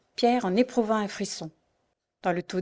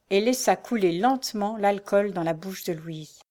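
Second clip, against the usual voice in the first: plain reading voice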